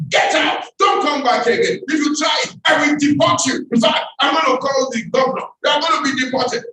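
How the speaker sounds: high, loud outraged yelling; furious mother. Retold or imitated. Mother throwing the bribing businessman out, furious high shout. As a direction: blast him like a mother insulted by a bribe.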